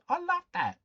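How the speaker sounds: high, loud delighted laugh; delighted squeal. Real, not acted. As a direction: squeal with joy at the memory.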